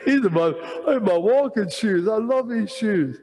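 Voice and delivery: half-laughing, clinging to his walking shoes; laughing protest. Retold or imitated. To the inner voice, laughing while refusing his shoes. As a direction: chuckle through the protest.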